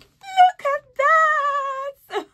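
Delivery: high, loud, slow squeal; excited squeal. Real, not acted. Showing the bag's card space, squealing high.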